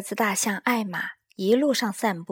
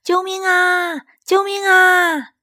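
high, slow, drawn-out cry for help; desperate call of 救命啊, much higher and louder; shouting for help; 10 semitones higher, 7 dB louder, slower than usual. Acted. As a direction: shout it across the forest. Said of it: "艾玛又听到大叫".

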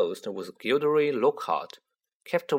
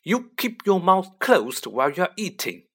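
higher-pitched motherly snap; snapped; 6 semitones higher, 4 dB louder than usual. A narrator's reading. Mother snapping at her son over table manners. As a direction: cut him off with a sharp telling-off. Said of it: "Missus Weasley snapped".

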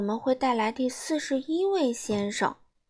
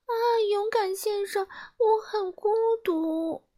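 high, slow, tearful little voice; high sobbing plea; crying; 11 semitones higher than usual. Acted. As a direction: whimper it out like a lonely girl. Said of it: "她哭着说".

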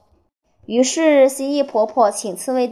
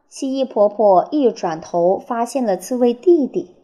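ordinary delivery

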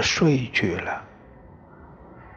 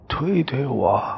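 plain reading voice

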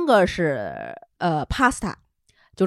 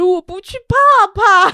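put-on higher, louder babyish whine; scared husband; 10 semitones higher, 10 dB louder than usual. Husband refusing island trip, scared baby voice. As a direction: whimper like a scared kid. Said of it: "我们王总是真不是".

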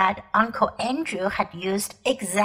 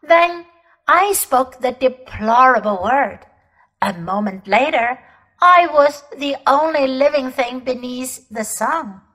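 commanding, louder queenly voice; proud imperious queen; 4 dB louder than usual. Acted. Queen boasting to the children of her war, imperious. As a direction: declaim it like a cold, proud empress.

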